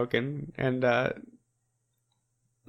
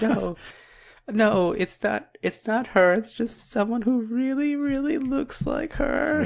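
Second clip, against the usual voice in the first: high flustered denial; 12 semitones higher than usual. Retold or imitated. Girl panics when her teacher finds her at the bar, high flustered voice. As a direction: squeak out a panicked excuse.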